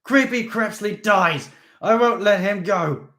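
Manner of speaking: high, loud, slow and hateful; raging. Acted. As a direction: scream your hatred. Steve raging.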